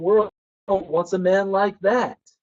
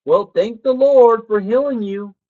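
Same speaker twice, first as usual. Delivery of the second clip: higher, louder and slow, a mother's firm faith; insistent mom; 6 semitones higher, 5 dB louder than usual. Retold or imitated. Mom telling her sick son to thank the Lord, firm and loud. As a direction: proclaim it like a devout mom. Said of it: "my mom would say".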